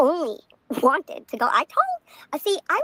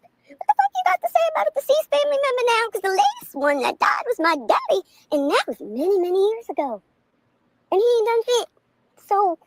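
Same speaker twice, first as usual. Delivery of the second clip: high, fast, heated challenge; mock confrontation; 11 semitones higher than usual. Confronting the host about her dead father, heated and fast. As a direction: fire it at him like a showdown. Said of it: "I was gonna ask".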